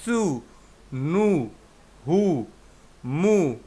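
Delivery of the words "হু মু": usual speaking voice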